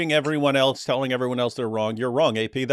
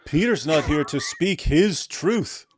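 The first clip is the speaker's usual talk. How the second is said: mock solemn; 4 semitones higher, slower than usual. Mocking Peter's phrase.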